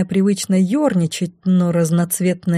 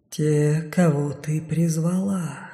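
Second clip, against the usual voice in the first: shadow voice; 2 semitones lower, 5 dB quieter, slower than usual. A narrator's reading. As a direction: speak as the dark around her. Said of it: "ответ прозвучал, казалось, со всех сторон". (тихий голос теней со всех сторон)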